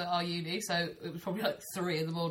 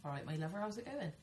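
soft West Country burr; Devon accent; 10 dB quieter than usual. Shown as a demonstration. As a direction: put on a Devon accent, quietly. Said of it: "Like Devon?".

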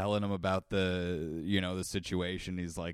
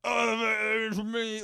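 drawn-out, high, anguished cry; pained yelling out; 13 semitones higher, much slower than usual. Retold or imitated. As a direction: overdo the passion, wail it slowly. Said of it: "even more passionate than that".

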